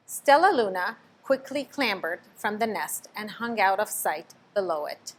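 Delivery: ordinary delivery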